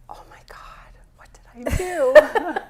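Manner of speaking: stage whisper of dread, retold with amusement; mock-panicked whisper. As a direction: whisper it like someone sure they're in trouble. Retells her dread before meeting.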